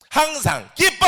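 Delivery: high, loud leading shout; leading the call. Shown as a demonstration. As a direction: call it out for the crowd to echo. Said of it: "한번 따라해 주세요". (높고 크게 선창)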